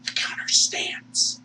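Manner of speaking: slow, whispered repeat; drawn-out voiceless hush; whispered echo. Real, not acted. Hushed echo of the declaration.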